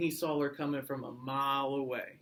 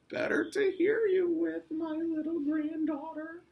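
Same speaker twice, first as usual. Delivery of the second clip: very high falsetto granny; exaggerated high mock-grandma; wolf pretending Grandma; 13 semitones higher than usual. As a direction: croon it in a squeaky fake-granny voice. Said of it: "pretended like he was Grandma".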